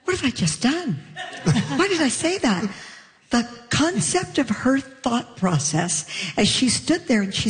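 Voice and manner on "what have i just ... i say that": high, laughing self-reproach; mock horror through laughter; laughing panic. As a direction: daughter realising her mistake, laughing. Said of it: "And then she went".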